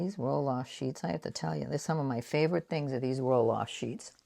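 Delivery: ordinary talk, nothing marked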